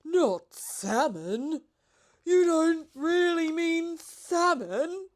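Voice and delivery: loud, high, slow disbelieving pelican; a pelican who can't believe his luck; drawn-out incredulous cry; overjoyed pelican. A narrator's reading.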